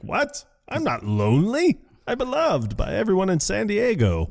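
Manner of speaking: high, indignant Ron Burgundy impression; mock-offended anchorman protest; imitating Ron Burgundy. Friend quoting Ron answering his dog, high and indignant. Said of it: "And he's like".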